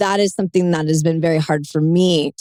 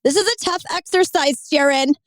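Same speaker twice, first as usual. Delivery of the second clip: tearful outburst; 9 semitones higher than usual. Real, not acted. Crying while laughing to host.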